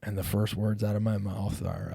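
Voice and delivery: ordinary delivery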